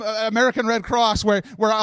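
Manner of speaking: ordinary talk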